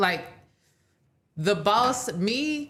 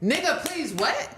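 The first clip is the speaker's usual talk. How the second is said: higher, incredulous snap of a retort; dismissive retort; 8 semitones higher, slower than usual. Retold or imitated. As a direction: brush him off with disbelief. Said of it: "if I wanna be like".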